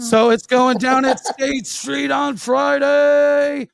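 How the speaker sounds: slow, high hype announcement; announcer hype. Acted. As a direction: sell the show like an ad. Show announcement.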